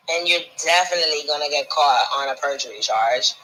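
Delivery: ordinary talk, nothing marked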